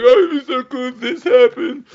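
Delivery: high and loud, gushing with excitement; excited daydream. Retold or imitated. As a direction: squeal the wish like a kid at Christmas. Daydreaming quote, "I'm like.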